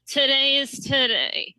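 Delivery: mock tipsy politician slur; slurred politician impression. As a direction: imitate her slurring, dragging the words out high. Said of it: "She's always kind of slurring".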